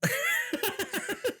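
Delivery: high-pitched burst of laughter. Real, not acted. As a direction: let out a high giggle. Laughing at own joke.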